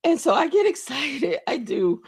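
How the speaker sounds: high, strained with rising tears; real tearful voice. Real, not acted. Host moved that people value the work, tearful. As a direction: push the words through welling tears. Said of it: "I'm crying literally live".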